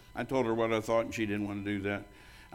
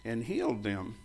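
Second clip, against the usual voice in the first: slow, quiet reading of He sent his word; slow scripture reread; 5 dB quieter, slower than usual. Shown as a demonstration. Pastor rereading the verse to the church, slow and hushed. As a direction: read it again slowly, letting it sink in. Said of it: "I wanna read this to you again".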